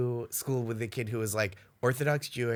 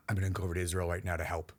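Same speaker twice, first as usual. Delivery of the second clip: quick, quieter mock zealot; 6 semitones lower, 6 dB quieter, much faster than usual. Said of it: "stuff that's like".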